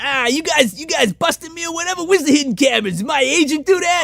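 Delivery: high, whiny put-on showbiz loudmouth; Max's obnoxious voice. Comic asking about hidden cameras, mock obnoxious high voice. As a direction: play a cocky comic sure he's been pranked. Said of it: "This is how he actually sounds".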